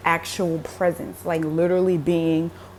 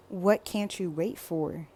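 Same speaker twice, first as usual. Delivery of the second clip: soft quoted question; 8 dB quieter than usual. Retold or imitated. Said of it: "he was like".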